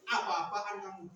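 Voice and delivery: usual speaking voice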